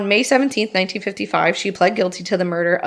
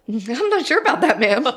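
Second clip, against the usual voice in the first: higher, teasing and overly polite; sassy mock reply; 5 semitones higher than usual. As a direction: tease her back with a raised, mocking ma'am.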